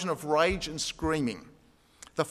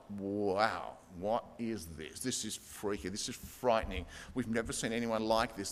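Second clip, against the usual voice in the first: hushed, much lower and softer; awed fear; 8 semitones lower, 8 dB quieter than usual. Retold or imitated. Synagogue crowd awed by an exorcism, hushed and frightened. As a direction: breathe it out, spooked and amazed.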